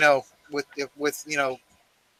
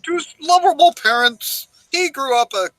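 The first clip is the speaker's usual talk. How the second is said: mock documentary narrator; 4 semitones higher than usual. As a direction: narrate like a biopic.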